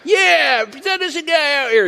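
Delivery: high mock heckling; hammed-up cheering from behind the rocks; mock jeering crowd. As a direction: egg him on from the hillside. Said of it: "little Philistines up on the hill going".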